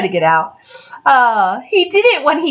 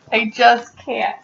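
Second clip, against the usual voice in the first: ordinary delivery, nothing marked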